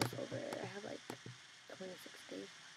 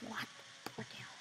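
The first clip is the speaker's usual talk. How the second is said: ordinary talk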